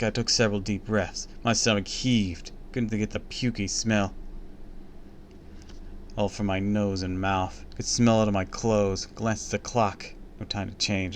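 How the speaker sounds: plain reading voice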